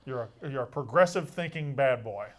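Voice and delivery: ordinary delivery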